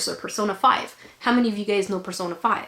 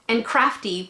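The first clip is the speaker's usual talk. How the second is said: ordinary talk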